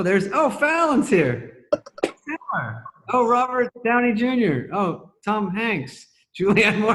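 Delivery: loud, high, drawn-out astonishment; starstruck surprise. Retold or imitated. Guest retells spotting stars on a Zoom, starstruck. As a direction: reel off each name in rising amazement.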